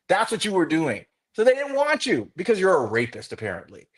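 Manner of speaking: high, indignant rant at a rival; angry tirade. Real, not acted. Fan telling off Micah Parsons over his remarks, angry tirade. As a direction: let him have it, voice pitched right up.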